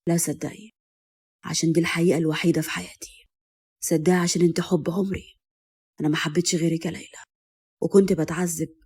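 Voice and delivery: low, hushed man's love confession; husband's calm, soft declaration; calm, tender. A narrator's reading. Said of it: "وقال بهدوء".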